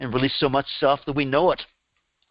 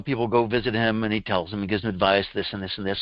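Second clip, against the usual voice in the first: ordinary delivery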